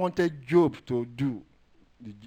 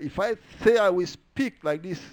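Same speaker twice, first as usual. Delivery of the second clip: raised, louder voice of complaint; aggrieved David; 5 semitones higher, 6 dB louder than usual. Retold or imitated. Preacher voices David as he was feeling, aggrieved. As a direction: say it higher and louder, full of grievance. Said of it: "the way he was feeling".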